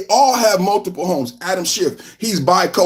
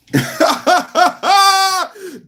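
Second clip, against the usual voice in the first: high laugh; 10 semitones higher than usual. Real, not acted. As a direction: burst out laughing.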